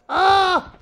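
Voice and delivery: very high, loud yelp; short high-pitched shriek; sudden scream. Real, not acted. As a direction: scream out in the heat of play. Startled scream during play.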